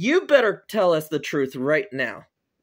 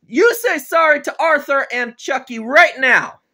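loud, high angry-dad telling-off; screaming scolding; 7 semitones higher, 8 dB louder than usual. Acted. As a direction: yell like a father laying down the law. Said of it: "You don't need to scream at me".

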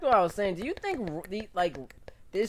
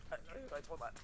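hushed; 3 semitones lower, 21 dB quieter, much faster than usual. Real, not acted. Repeats line a third time, hushed.

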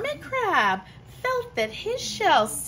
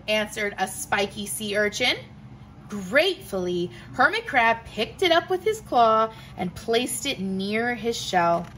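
plain reading voice

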